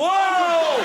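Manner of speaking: falling yell. Acted. Falling out the window.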